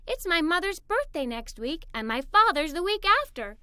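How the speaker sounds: ordinary delivery, nothing marked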